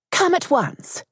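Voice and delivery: stern mother. Acted. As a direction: snap the order like a strict lady. Mother summons daughter sharply.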